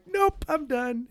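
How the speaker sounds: put-on high, slow child voice; high-pitched mock refusal; mimicked little kid. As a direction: playfully imitate a toddler calling it quits.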